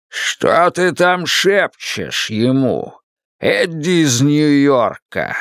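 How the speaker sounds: slow, grand mocking wizard; booming Oz voice. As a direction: sneer slowly like a wizard. Oz voice mocks Eddie.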